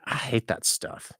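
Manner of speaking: hushed, breathy 'I hate that stuff' under the breath; a slow, voiceless whispered dismissal; whispered retort. Retold or imitated. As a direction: whisper it with mock disgust. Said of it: "And I was like".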